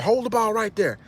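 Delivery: casual instruction. Retold or imitated. Carlito instructing a thug, high casual command. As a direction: set the trap smoothly. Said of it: "He said".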